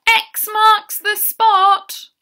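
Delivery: high, loud, drawn-out pirate-style call; shouting. Acted. Llama swinging in to rescue the herd, shouting. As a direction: shout it out slowly like a dashing pirate.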